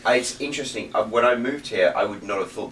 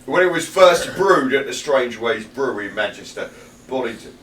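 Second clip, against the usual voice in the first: louder, hammed-up Manchester ad read; attempted Manchester accent; 3 semitones higher, 6 dB louder than usual. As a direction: read it like a bad Manchester brewery advert. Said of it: "I can't do a Manchester accent".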